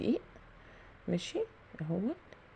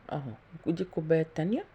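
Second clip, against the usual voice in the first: usual speaking voice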